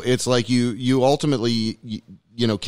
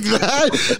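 laughing; 8 semitones higher than usual. Retold or imitated. Quoting himself while laughing.

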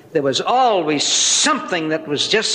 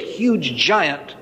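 ordinary talk

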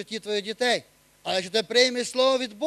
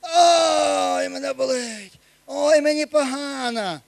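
mock groaning sufferer, lightly voiced; whiny complainer; slower than usual. Preacher mimics a complaining believer, mild whine. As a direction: moan about the pain like a self-pitying believer. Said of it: "Ти приймаєш слово змія".

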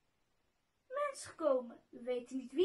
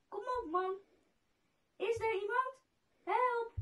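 higher-pitched storybook shout, building to the last word; acted shouting; 8 semitones higher, 4 dB louder than usual. A narrator's reading. Trapped Goos shouts for anyone to help, acted. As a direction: call out, rising in pitch and force. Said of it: "schreeuwde hij".

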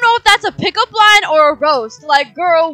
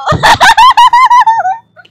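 shrieking burst of laughter; loud high laugh; 11 dB louder than usual. Real, not acted. After own pickup line.

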